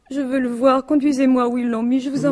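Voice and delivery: a widow begging to see her husband; urgent demand. Acted. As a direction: demand it, voice climbing. Widow insists on seeing the body.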